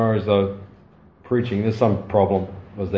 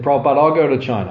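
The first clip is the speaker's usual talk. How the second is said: louder, eager offer to go; eager volunteering; 4 semitones higher, 6 dB louder, faster than usual. Retold or imitated. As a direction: jump in eagerly, a bit raised.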